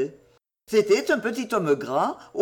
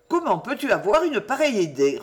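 ordinary delivery

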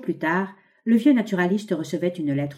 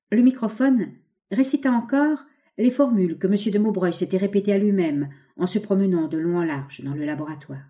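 plain reading voice

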